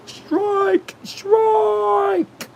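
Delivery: high, drawn-out strike call; sustained high-pitched calling; umpire strike call. Pretend. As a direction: stretch it out like a mock umpire.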